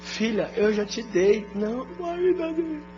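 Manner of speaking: put-on whining child. Daughter begging father for money.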